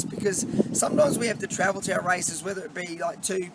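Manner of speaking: usual speaking voice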